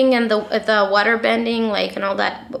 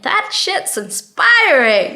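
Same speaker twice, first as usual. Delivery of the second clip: slow, high and loud, hammed-up awe; drawn-out mock wonder at bathroom graffiti; 8 semitones higher, 5 dB louder, much slower than usual. Reacting to graffiti with slow, high mock awe. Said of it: "And I'm like".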